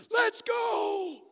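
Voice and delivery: high, loud battle cry; Jehu's wild charging shout. Retold or imitated. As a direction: yell it like a charging king. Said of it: "Jehu ... he's like".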